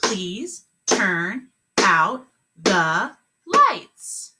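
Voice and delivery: very slow, word by word; clapped words. Shown as a demonstration. Teacher clapping the sentence, very slow. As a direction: clap each word slowly. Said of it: "Let's clap it".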